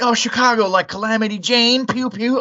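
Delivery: high, excitable mocking imitation; teasing classmates. Mimicking college kids teasing a Chicagoan, high and silly.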